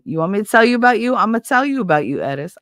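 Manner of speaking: cheeky challenge to a friend; sassy. Real, not acted. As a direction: fire it back with playful attitude. Answering Edith's request playfully.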